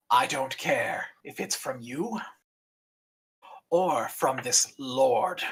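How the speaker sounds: firm, proud dragon, slow resolve; an old dragon standing her ground; defiant old dragon. Acted. Old dragon Ember defies.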